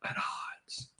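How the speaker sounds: unvoiced, breathy whisper. Real, not acted. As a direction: drop to a whisper. Whispered repeat of headline.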